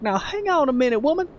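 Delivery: high, drawling country man putting someone down; condescending cowboy, pitch climbing well up; Cowboy Craig. Retold or imitated. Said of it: "He'd be like".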